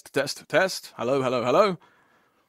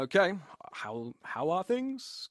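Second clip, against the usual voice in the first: normal talking voice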